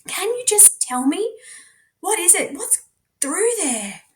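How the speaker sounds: high, soft frightened plea; frightened pleading. Acted. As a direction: beg him to just say.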